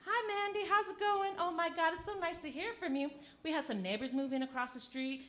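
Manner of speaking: put-on high, sweet old lady voice; chirpy grandma gossip, high pitched; grandmother voice. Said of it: "she's always like".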